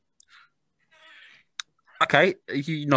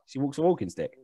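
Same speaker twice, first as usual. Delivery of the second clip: usual speaking voice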